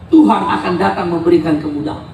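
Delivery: normal talking voice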